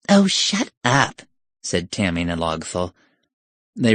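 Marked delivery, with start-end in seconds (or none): 0.1-1.2 high, slow put-down; exasperated. Acted. Tammy cutting off Septima, exasperated. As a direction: drag it out high at your friend. Girl snubs her friend's wordplay.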